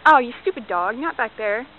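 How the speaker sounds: ordinary talk, nothing marked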